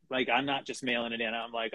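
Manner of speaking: ordinary talk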